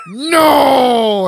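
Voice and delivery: very loud, high, long-held cry; over-the-top drawn-out yell; bellowed no. Acted. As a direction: howl the refusal dramatically. Bellowed refusal, spills his whiskey.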